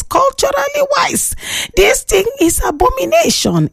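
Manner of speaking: high-pitched scandalised proclamation; mock outrage. Host voicing a listener calling it abomination, in mock outrage.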